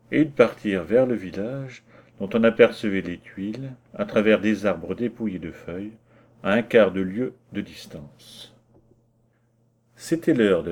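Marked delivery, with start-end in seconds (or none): none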